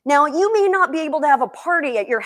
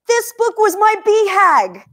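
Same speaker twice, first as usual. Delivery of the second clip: overcome, on the verge of crying; real emotion, choking up as she speaks; emotional, near tears; 7 semitones higher, 6 dB louder than usual. Real, not acted. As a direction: voice rising high and loud with emotion. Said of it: "I'm so emotional right now".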